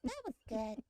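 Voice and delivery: dainty ladylike voice. Retold or imitated. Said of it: "she'll clean her plate and be like".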